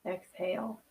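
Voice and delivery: soft, hushed meditation voice; a quiet spiritual breathing guide; guided meditation. Acted. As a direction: guide them into calm, breath by breath.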